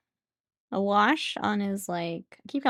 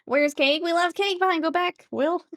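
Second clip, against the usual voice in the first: high, loud, playful panic; mock alarm; 7 semitones higher, 6 dB louder than usual. Passenger telling Will cake was left behind, mock alarm. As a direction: call out urgently in mock distress.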